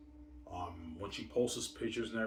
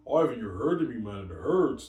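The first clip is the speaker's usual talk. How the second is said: higher, louder whining plea of pain; mock whiny plea; 3 semitones higher, 9 dB louder than usual. Imitating V J pleading with Ivan, louder whine. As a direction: whine it like she's hurting.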